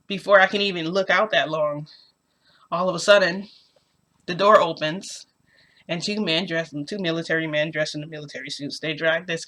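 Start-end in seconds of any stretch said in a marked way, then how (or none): none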